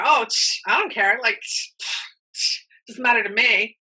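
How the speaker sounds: imitating a dismissive "Oh, tch, I don't care" with tuts; mock indifference. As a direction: brush it off like you don't care, tutting. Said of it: "pretended that you don't care".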